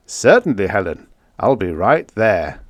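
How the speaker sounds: an affable president leaning in; a hint of a genial elderly statesman; President Collins. A narrator's reading. Collins answers secretary.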